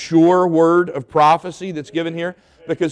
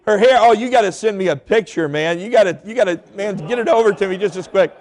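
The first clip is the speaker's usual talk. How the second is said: relieved laughing disbelief; 5 semitones higher than usual. Retold or imitated. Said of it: "I started laughing".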